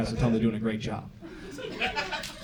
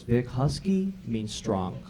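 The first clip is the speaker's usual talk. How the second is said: quoted mom; 3 semitones lower, 8 dB quieter, much slower than usual. Retold or imitated. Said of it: "She goes".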